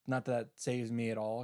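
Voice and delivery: ordinary talk, nothing marked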